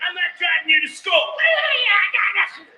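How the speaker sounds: loud, fast bickering shout; teen argument. Retold or imitated. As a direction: snap back at your sister, loud. Daughters arguing about school ride.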